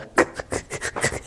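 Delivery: short, quiet chuckle; amused chuckle. Real, not acted. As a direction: chuckle at your own line. Laughs at his own dialogue. (दबी हुई हँसी)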